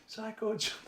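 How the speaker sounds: high, loud disbelieving question; incredulous echo. Retold or imitated. Man echoing his friend's nickname for the psychiatrist, incredulous. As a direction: repeat it back in amused disbelief.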